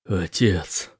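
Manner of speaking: choked, quiet single word; tearful. Acted. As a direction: hold back tears on it. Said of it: "Слёзы заливают его глаза".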